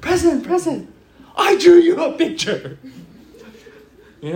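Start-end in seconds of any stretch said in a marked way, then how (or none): 0.0-2.8 put-on much higher, louder childlike calling; childish plea. Pastor pretending to rush the president, high childish call. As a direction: beg like an eager child. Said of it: "ran to the president and go".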